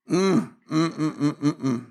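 low, closed-mouth "mm-mm-mm"; reluctant humming. Pretend. Wordless reaction to many trials.

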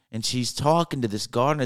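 ordinary delivery

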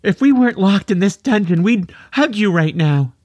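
tearful mother, high and quick; high-pitched and raspy. A narrator's reading. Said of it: "also speaking with a high-pitched and raspy voice".

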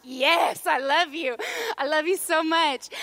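mock-gushing, emphatic thanks; gushing delight. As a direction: gush with exaggerated love. Acting delight at pizza arriving.

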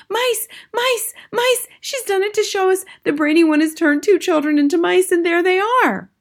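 very high excited shriek; crying out. A narrator's reading. Witches spotting mice on the platform, crying out. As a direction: squeal it out in a high, loud voice. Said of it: "pointing at the platform and crying out".

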